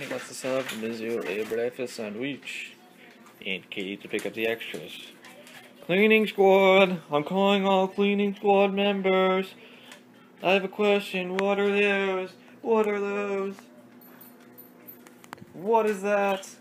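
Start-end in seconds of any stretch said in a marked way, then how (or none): 5.8-16.5 loud, high and slow announcer call; mock rally call. Calling the dogs in a loud, high mock shout. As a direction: summon the troops, hammed up.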